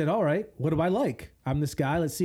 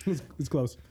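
normal talking voice

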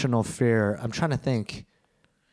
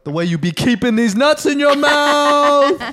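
loud, high, drawn-out taunt; triumphant punchline; 11 semitones higher, 7 dB louder, slower than usual. Real, not acted. Friend springing a crude joke on a friend, triumphant shout. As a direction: yell the gotcha, stretching the ending.

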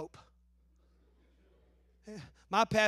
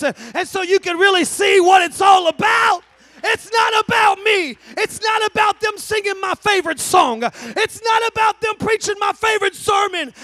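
shouted, high preaching build-up; full-force preaching; 9 semitones higher, 16 dB louder than usual. Acted. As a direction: hammer it home like a revival preacher.

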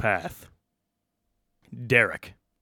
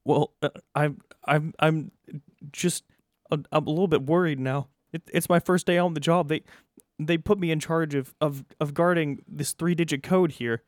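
higher, stammering first-day worker; nervous new guard; 7 semitones higher, 4 dB louder than usual. Acted. New vault guard on his first day, worried and higher-pitched. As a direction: fumble the words like a worried rookie guard.

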